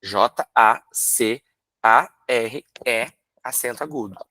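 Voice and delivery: slow letter-by-letter "J A C A R E"; teacher spelling the word aloud for children; spelled out. Shown as a demonstration. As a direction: spell it out one letter at a time, slowly. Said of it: "soletrar jacaré".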